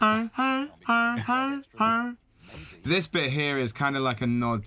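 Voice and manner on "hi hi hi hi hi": high, squeaky honking sounds in rhythm; mock honks. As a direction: honk like a goose in time. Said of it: "a series of honks".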